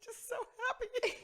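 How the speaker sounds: high, squeaky mock sob; laughing, hammed-up tearful joy; happy crying. Mom answering her child, mock weepy with happiness. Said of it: "Why are you always fucking crying".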